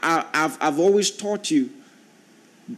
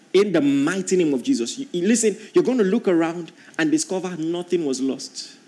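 ordinary talk, nothing marked

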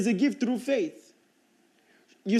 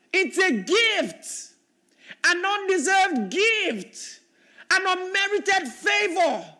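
full-force preaching; 11 semitones higher, 5 dB louder than usual. Real, not acted. Preacher to congregation, shouting that grace cannot be boasted of. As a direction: drive it home to the crowd.

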